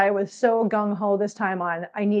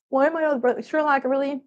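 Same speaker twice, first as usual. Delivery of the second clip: normal talking voice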